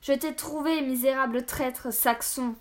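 loud warrior's challenge, high then slowing; bellowing. A narrator's reading. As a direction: bellow the accusation, drawing out the insult. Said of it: "qui beuglait".

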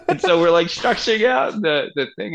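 high, loud laughter-filled speech; laughing while talking. Real, not acted. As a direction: push the words out through a laugh. Talking on through his own laughter.

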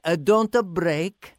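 put-on a light Italian accent, leaning in close; whisper-close Italian accent to a castmate. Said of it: "said in the Italian accent".

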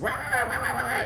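high-pitched rapid mock dog barks; imitated frantic barking. Dog in a man's lap going nuts at other dogs, imitated barking. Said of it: "just, just going nuts".

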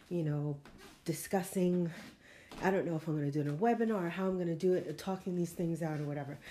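ordinary delivery

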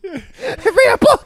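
very high, very slow mock librarian with a laugh; scary librarian. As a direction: stretch it out like a laughing stern librarian. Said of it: "Mom sounds like the scary librarian".

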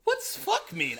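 put-on innocent kid question. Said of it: "I was like".

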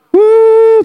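long, loud, high woo; joyful whoop. Real, not acted. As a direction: let out a high whoop of praise. Praise outburst in sermon.

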